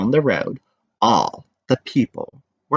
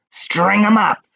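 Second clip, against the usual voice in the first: higher mock angry call; angry shout; 10 semitones higher, 3 dB louder than usual. Townsmen shout to punish Big Anthony, mock angry. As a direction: demand punishment. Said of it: "the men of the town shouted".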